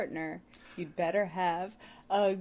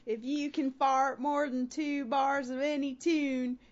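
put-on slow, drawn-out Baltimore accent; slower than usual. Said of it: "no, that's all Baltimore".

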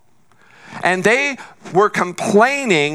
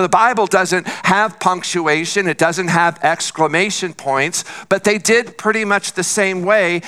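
usual speaking voice

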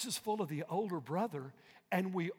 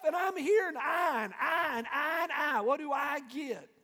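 higher, louder boastful whine; older brother talking smack; 8 semitones higher, 6 dB louder than usual. Retold or imitated. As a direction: brag and grumble like the resentful older son. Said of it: "Talking smack".

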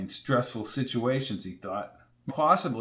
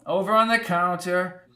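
put-on old landlady; 6 semitones higher than usual. A narrator's reading. Landlady voiced.

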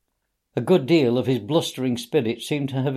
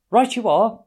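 higher, louder eager agreement; eager cry; 10 semitones higher, 6 dB louder than usual. A narrator's reading. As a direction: jump up ready to go. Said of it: "cried the Rat".

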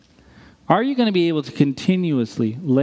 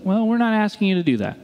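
ordinary talk

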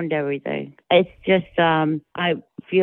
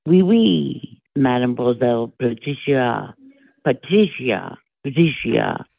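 lightly put-on French accent, words dragged out; mock the French suitor with a light drawn-out accent; imitating a French charmer; 2 semitones lower, slower than usual. Tan Mom imitates her French suitor, light mock French accent. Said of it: "Imitate it".

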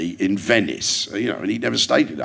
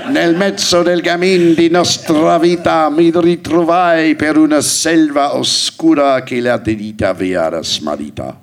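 mock-grand Italian verse; funny Italian recitation; 6 semitones higher, 4 dB louder than usual. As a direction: recite Dante like a hammy Italian poet. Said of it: "Doing my funny Italian".

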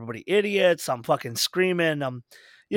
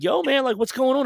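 higher-pitched, puzzled questioning; retold puzzled check-in; 9 semitones higher than usual. Storyteller retelling how he approached the sulking musician, high and puzzled. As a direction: ask it high, as if baffled by him.

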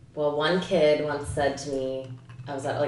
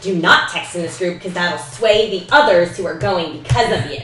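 louder, emphatic rule-giving mom; imitated strict mom; 3 semitones higher, 7 dB louder than usual. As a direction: lay down the law word by word, as mom. Voices mom laying down group rules.

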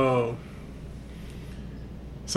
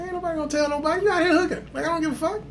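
quick brush-off; 11 semitones higher, 3 dB quieter, much faster than usual. Retold or imitated. Retold reply to the girl.

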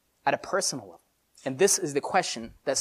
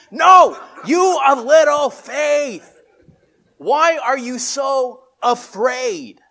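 high, loud, slow shout; Jesus shouting to disciples over the storm; yelling over storm; 9 semitones higher, 10 dB louder, much slower than usual. Acted. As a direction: yell it over the wind. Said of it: "everyone has to yell".

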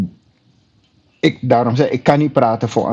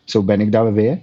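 ordinary talk

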